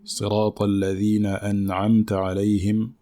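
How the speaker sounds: measured chanted tajweed recitation; Quran recitation. Shown as a demonstration.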